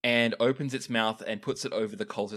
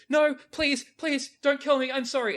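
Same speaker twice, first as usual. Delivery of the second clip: high, panicked pleading; terrified young cultist pleading; begging cultist; 5 dB louder than usual. Acted. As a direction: beg for your life in a high voice. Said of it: "scrambles back and says".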